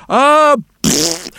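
a grunt then a spitty pfft as the fist lands; mock splat. A narrator's reading. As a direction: smash it with a playful pfft. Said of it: "with his fist he goes".

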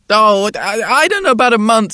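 put-on high, loud child's voice; a small boy answering the policeman; little boy voice. A narrator's reading. Boy's voice in story.

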